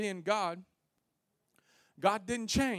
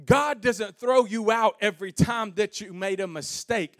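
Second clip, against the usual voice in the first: loud, building pulpit proclamation; full-force preaching; 3 semitones higher, 10 dB louder than usual. Real, not acted. Preacher encouraging somebody who made a mistake, full-force preaching. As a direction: preach it out with rising force and volume.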